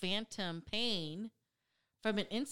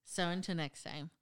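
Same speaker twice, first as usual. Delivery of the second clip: ordinary talk, nothing marked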